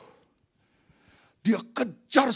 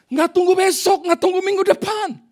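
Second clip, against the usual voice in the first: high, loud proclamation; triumphant shout; 13 semitones higher, 11 dB louder, slower than usual. Real, not acted. Preacher proclaims God repays at once, triumphant shout. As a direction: shout it out high, driving each phrase home.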